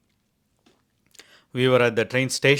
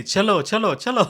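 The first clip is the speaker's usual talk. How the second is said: high, raised Hindi urging; 9 semitones higher, 5 dB louder, faster than usual. Retold or imitated. Telling the driver in Hindi to go, urgent. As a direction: hurry the driver along, loud and high.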